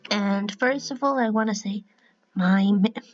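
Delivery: ordinary talk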